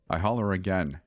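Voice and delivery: plain reading voice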